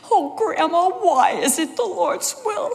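put-on high, plaintive small-child voice; upset little girl. Five-year-old asking Grandma as she is picked up, plaintive. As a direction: imitate a five-year-old wailing a question.

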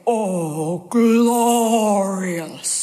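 a hint of Aunt Essie, low on "Oh"; lightly imitating an aunt savoring "glorious"; lightly voiced aunt drawing out "glorious"; Aunt Essie's "glorious". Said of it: "Aunt Essie used to say".

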